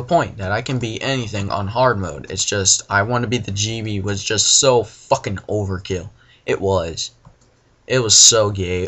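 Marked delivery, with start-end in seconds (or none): none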